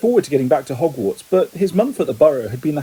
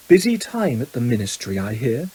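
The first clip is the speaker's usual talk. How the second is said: low, lightly voiced sneering aristocrat; haughty villain; 5 semitones lower than usual. A narrator's reading. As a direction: needle a rival in a low voice. Mr Malfoy taunts his rival.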